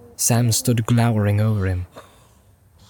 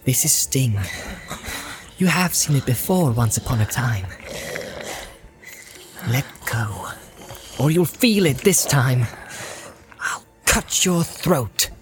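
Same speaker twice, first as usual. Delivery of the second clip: quiet, measured threat; threatening Frodo; 4 dB quieter than usual. Acted. Frodo at sword point warning Gollum, measured menace.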